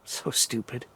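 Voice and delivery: barely voiced grumble at herself; under-breath self-scolding. Real, not acted. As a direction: mutter it as if no one should hear. Muttering to self in fear.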